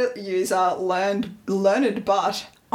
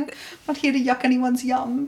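normal talking voice

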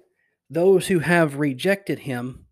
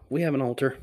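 blah; 6 dB quieter, much faster than usual. Shown as a demonstration. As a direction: say it blandly, without emphasis. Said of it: "it's kinda blah".

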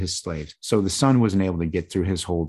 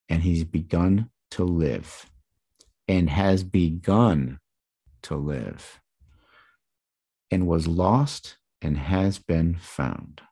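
slow emphatic reading; slower than usual. Acted. Pastor reads the father's words slowly.

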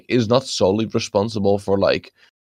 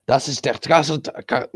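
put-on German accent, higher; mock German; 5 semitones higher, faster than usual. Shown as a demonstration. Showing friends German words, mock German accent. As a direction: say "Das ist der Kartoffel" with German vowels and a hard K. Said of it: "the German word for potato".

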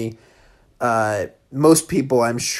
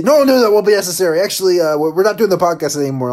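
higher, louder, fast hammed-up brush-off; flustered brush-off; 5 semitones higher, 5 dB louder, much faster than usual. Hosts hastily admit they're ending the podcast, fast flustered. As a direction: rush the awkward letdown. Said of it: "implied they go".

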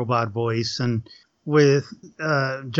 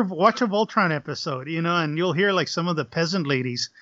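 ordinary talk

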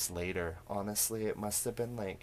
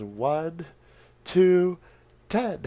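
climbing higher and louder on each number; mock countdown; 10 semitones higher, 10 dB louder, much slower than usual. Old woman counting down at the creeper, rising to a shout. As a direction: skip straight to the last number in a shout. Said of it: "then she literally says".

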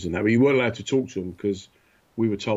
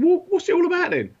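high and loud, baffled; mock indignant shout; exasperated demand; 6 dB louder than usual. Demanding explanation from sentry.

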